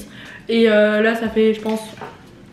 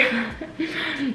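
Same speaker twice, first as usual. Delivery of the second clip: laugh; 4 semitones higher, 9 dB quieter than usual. Real, not acted. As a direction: burst of real laughter. Funny question makes her laugh.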